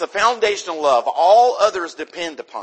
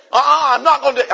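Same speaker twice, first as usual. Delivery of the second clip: rebellious refusal; 9 semitones higher, 8 dB louder, much faster than usual. Retold or imitated. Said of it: "and saying".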